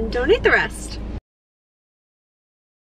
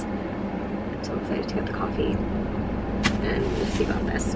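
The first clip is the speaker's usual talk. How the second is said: whispering; 9 dB quieter than usual. Real, not acted. Whispering at the drive-thru.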